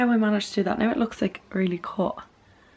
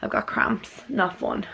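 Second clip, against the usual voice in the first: ordinary delivery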